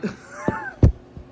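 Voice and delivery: high, loud cackle at oneself; burst of laughter. Real, not acted. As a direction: crack up at your own failed attempt. Cracks up at own accent attempt.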